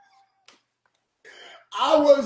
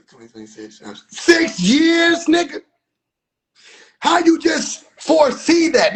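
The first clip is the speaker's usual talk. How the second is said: loud outraged rant; angry rant; 4 semitones higher, 5 dB louder than usual. Real, not acted. Angry rant at the crowd's predictions. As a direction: blast them for being lazy.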